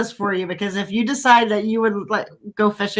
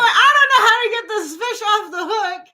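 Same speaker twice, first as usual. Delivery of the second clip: higher, half-laughing helplessness; laughing panic; 9 semitones higher than usual. Retold or imitated. Stuck at sea with a fish, laughing helplessly. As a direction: laugh through the mock panic.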